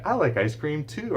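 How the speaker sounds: put-on high, bright child voice; imitated child copying the adult; child repeating. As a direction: echo it like a toddler. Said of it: "the child often will say".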